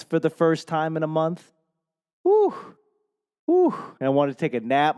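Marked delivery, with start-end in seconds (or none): 2.2-3.7 high, breath-blown whoops of relief; two high "whew"s; overwhelmed whew. Acted. First rice in a month, overwhelmed whew.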